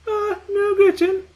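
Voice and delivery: high, loud whiny mimicry; mocking mimic. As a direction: parrot her back in a mocking squeak. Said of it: "mimics Gretchen".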